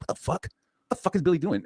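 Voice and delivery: high-pitched disbelief, retold; exasperated, high re-enacted outburst; incredulous outburst. Retelling his baffled reaction.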